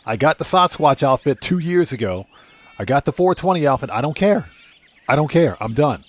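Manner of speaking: ordinary delivery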